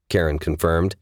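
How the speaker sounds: usual speaking voice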